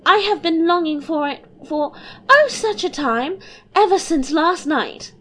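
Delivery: put-on high, bubbly girlish voice; a light, giddy young princess. Princess teasing her papa and mamma, high and bubbly.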